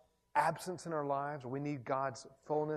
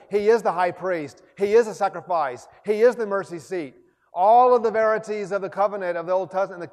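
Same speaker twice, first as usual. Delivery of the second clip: loud rising sermon climax with hallelujahs; full-force preaching; 4 semitones higher, 11 dB louder than usual. Acted. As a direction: build it like a revival preacher proclaiming. Preaching climax, shouted praise.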